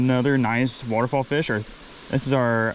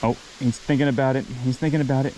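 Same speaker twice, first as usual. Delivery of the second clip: ordinary talk, nothing marked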